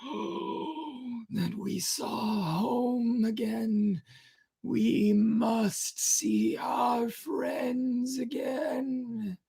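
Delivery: high, slow, crying whisper of longing; strong feeling. Acted. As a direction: weep out the wish for home. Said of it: "as if with strong feeling".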